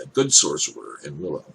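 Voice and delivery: usual speaking voice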